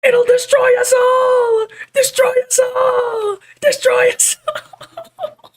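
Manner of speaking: high, slow screaming villain imitation; cartoon villain scream. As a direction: scream it like a cartoon doom prophet. Repeating cartoon villain line.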